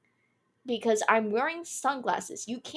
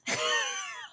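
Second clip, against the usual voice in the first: breathy high laugh; 4 dB quieter than usual. Real, not acted. Laughs at the guy leaving.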